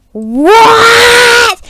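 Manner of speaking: long, high shouted disbelief; outraged fairy goddess. Acted. Fairy goddess hears of the killing, shrieked shock. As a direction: stretch it into a loud, high cry. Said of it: "the fairy goddess is like".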